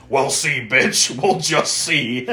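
drawn-out menacing taunt, lightly acted; hammed-up parting threat; mock villain threat. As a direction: stretch the last words like a retreating villain. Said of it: "and then goes".

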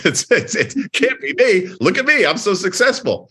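high-pitched, laughing self-mockery; mock self-importance. Mocks own past blaming of associates.

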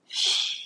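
a soft unvoiced hush; hushing shh. Shown as a demonstration. Preacher hushing her listeners, soft unvoiced shh. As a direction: shush gently as if quieting a room. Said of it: "He wants to whisper to you".

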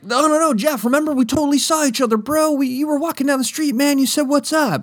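very high, fast, frantic pleading; desperate pleading kid. Retold or imitated. New kid begging Jeff to remember, very high and fast. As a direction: beg frantically, rushing the words.

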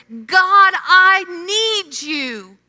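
high, loud, slowed cry to God; desperate plea. Retold or imitated. Preacher voicing a desperate believer, loud slow cry. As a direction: plead with God at the end of your rope. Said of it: "get to that point where we are desperate".

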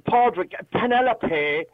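mock Eton accent. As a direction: parody affluent Dublin Four speech, drawn out. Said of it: "you think they went to Eton".

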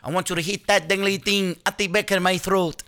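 high-pitched put-on Mexican accent; hammed-up Mexican-accented rapper; mock Mexican accent. Co-host parodying rap lyrics in a high Mexican accent. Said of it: "Mexican version".

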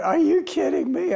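high, loud retold panic; panicked disbelief. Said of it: "absolute panic".

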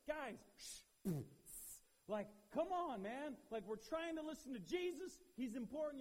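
loud, high scolding hush; shushing rebuke. Retold or imitated. Crowd scolding the blind men, shushing. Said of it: "This is like".

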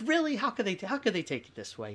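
high, loud exasperated "Really?"; retold indignant outburst; exasperated disbelief. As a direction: complain in disbelief, pitch jumping up. Retold frustrated complaint to God.

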